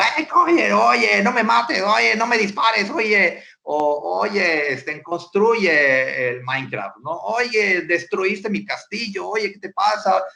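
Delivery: hammed-up gamer pleading not to be shot; excited gamer yelling. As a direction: squeal like a teen caught up in Minecraft. Teen yelling at videogame.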